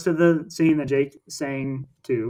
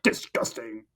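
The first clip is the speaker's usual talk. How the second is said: disgusted king; 5 semitones lower, 7 dB quieter, slower than usual. Retold or imitated. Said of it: "King Harold is like".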